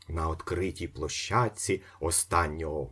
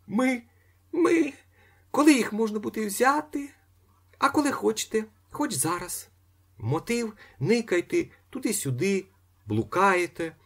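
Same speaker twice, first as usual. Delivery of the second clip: much higher lady's voice; young lady; 3 dB louder than usual. A narrator's reading. Young lady arranging pickup, high voice. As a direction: chirp it as a young woman.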